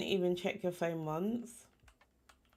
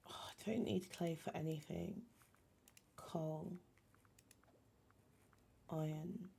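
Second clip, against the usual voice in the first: whisper; 2 semitones lower, 9 dB quieter than usual. Real, not acted. Muttering to self while crafting.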